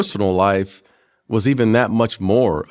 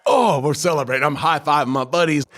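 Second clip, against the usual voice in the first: raised pitch, whooping it up with buddies; higher-pitched retold elation; retold celebration; 6 semitones higher than usual. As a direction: act out an excited celebration.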